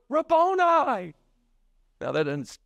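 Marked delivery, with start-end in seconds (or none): 0.1-1.1 high, drawn-out cry of recognition; acted excited call to a teacher; acted exclamation. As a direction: cry RABBONI out high and loud-ish, stretched. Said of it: "she goes".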